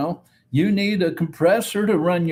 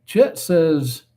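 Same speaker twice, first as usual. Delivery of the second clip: normal talking voice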